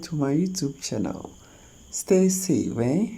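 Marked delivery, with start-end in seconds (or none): none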